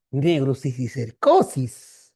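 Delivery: slow, drawn-out doubtful echo; mocking disbelief. Resident doubting the intern's diagnosis, slow and mocking. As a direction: stretch the word out in mock disbelief. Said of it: "Así todo burlón".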